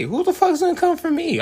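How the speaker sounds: high, loud, squealing brag; hyped boast. Pretend. Bragging about his fantasy empire, high excited shout. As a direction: brag like nobody can touch you.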